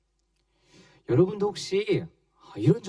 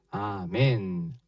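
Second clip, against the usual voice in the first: normal talking voice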